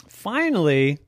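plain reading voice

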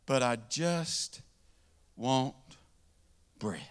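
slow, soft, word by word; drawn-out plea. Acted. Hungry man to the bread store guy, slow and soft. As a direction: stretch each word, worn out.

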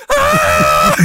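high, loud panicked scream; yelling scream. Retold or imitated. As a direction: scream flying down a hill out of control. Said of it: "like, yelling".

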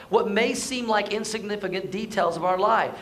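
normal talking voice

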